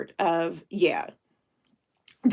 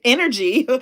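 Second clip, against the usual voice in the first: the word energy said with a laugh, louder and higher; a viewer praises her energy, she repeats energy through a laugh; 4 semitones higher, 5 dB louder than usual. Real, not acted. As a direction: laugh as you echo energy, delighted. Echoing the comment with a laugh.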